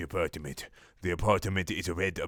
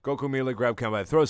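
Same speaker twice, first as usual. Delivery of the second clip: ordinary delivery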